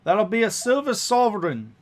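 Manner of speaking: high, loud voice demanding the fare; woman carriage driver. Acted. Cab driver naming the fare, loud high voice. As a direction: call out the price, loud and high.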